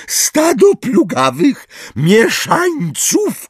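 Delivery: slow, high squeaky cry; Umbridge. A narrator's reading. Umbridge insults the centaurs, squeaky cry. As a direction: screech the insult, drawn out. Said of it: "krzyknęła piskliwym głosem Umbridge".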